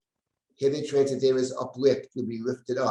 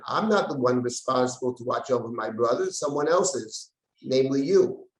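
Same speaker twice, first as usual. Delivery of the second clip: usual speaking voice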